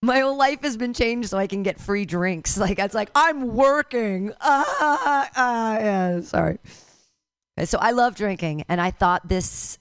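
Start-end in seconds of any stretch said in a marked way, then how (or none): 3.1-6.6 drawn-out smug excuse; a slow, smug excuse to the bartender; slow mock excuse. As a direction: stretch it out like an alibi. Quoting self at the bar.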